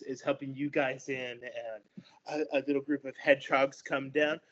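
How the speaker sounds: usual speaking voice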